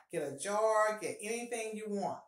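ordinary talk, nothing marked

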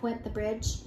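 ordinary delivery

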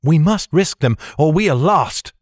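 lightly voiced heroine, high and pressing; urgent heroine. A narrator's reading. Heroine insisting on the roofs, lightly urgent.

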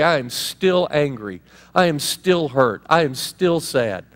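slow, heavy, deliberate; slow admission. Shown as a demonstration. Pastor voicing a griever admitting pain, slow. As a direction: lay out each word slowly.

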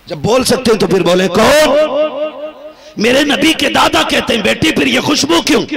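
loud, high call to the audience; rousing the crowd. Real, not acted. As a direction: demand a response from the crowd. Preacher demands crowd response. (ऊँची पुकार, श्रोताओं से जवाब माँगते हुए)

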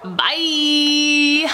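usual speaking voice

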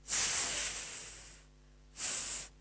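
"S" as a long voiceless hiss, no vowel; letter sound. Shown as a demonstration. Teacher to child showing the sound of the letter s. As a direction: hiss the "S" like a snake.

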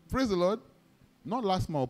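normal talking voice

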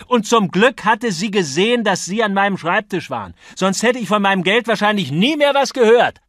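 very high, loud indignation; furious outburst. A narrator's reading. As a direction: rail at your opponent in outrage. Acted quarrel, bitter accusation.

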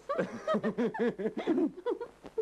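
high coy giggle; girlish giggle. Acted. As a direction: giggle shyly like a young girl.

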